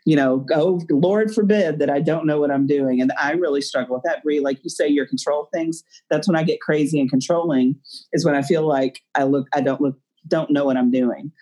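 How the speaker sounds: ordinary talk, nothing marked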